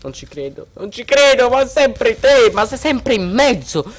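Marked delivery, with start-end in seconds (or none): none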